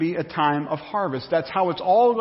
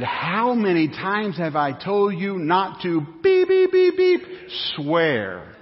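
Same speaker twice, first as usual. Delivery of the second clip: scolding mother; 4 semitones higher, slower than usual. Retold or imitated. Mother scolding daughter for swearing, stern then high beeps. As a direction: play the mother getting in her face.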